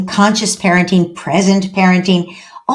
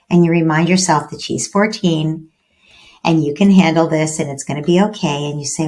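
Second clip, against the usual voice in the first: usual speaking voice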